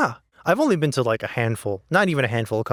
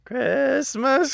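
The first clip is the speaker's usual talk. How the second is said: chipmunk voice; 11 semitones higher than usual. Pretend. Singing chipmunk song together.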